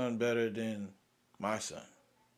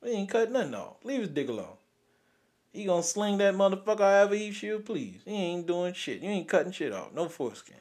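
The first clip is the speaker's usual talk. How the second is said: higher, emphatic mock insistence; firm refusal; 6 semitones higher than usual. Father refusing circumcision to nurse, emphatic. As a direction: shut it down hard, half joking. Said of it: "I ought to tell you".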